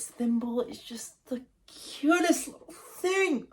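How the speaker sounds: high, slow, breathy gush over something adorable; cutesy gushing. Real, not acted. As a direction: coo it like talking about a puppy. Gushing over a favorite character.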